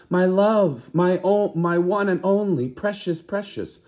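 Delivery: high, loud gushing cry; doting mother. Acted. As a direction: gush over the baby like a mad mother. Acted mother's mad cry.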